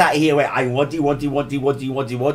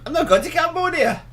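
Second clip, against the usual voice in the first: indignant. Real, not acted. Indignant denial, very high.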